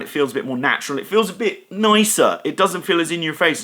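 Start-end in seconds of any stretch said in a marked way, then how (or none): none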